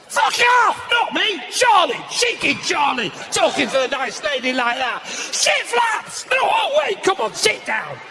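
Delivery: acted-out sudden high shouts; shouted Tourette's outbursts. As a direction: blurt it out in mock uncontrollable bursts. Imitating man with Tourette's shouting outbursts.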